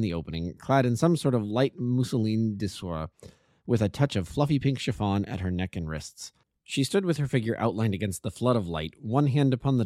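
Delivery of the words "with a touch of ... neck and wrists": ordinary delivery, nothing marked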